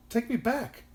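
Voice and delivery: put-on high-pitched little-girl plea; pleading cry. A narrator's reading. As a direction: beg to be taken home. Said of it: "cried the little girl".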